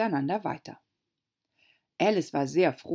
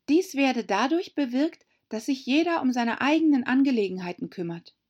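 put-on a higher young girl's voice; Alice, girlish; 8 semitones higher, 3 dB louder than usual. A narrator's reading. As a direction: muse aloud as a little girl. Alice widerspricht. (Alice spricht zu sich selbst, mädchenhaft höher)

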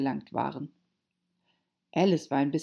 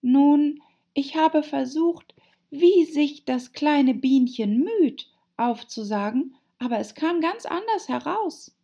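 high, plaintive Alice voice; girl character, a touch of sadness; troubled; 11 semitones higher, 6 dB louder than usual. A narrator's reading. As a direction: confess it with mild dismay, pitched up. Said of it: "erwiderte Alice betrübt".

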